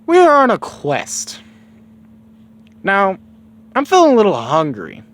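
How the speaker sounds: high, loud put-on kid voice; Goku. Goku interrupting Bulma about the quest, high-voiced. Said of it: "Goku's like".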